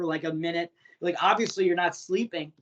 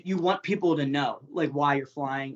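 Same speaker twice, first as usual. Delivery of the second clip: ordinary talk, nothing marked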